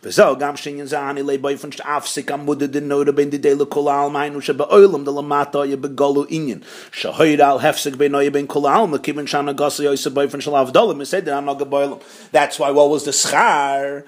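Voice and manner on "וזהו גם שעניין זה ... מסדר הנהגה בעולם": low, rapid monotone recitation; reading text aloud. Acted. Teacher reading the source text to students, low quick monotone. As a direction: read it on one low note, very fast.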